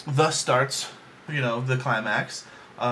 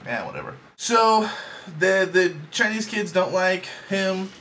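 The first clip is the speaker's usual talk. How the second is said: ordinary delivery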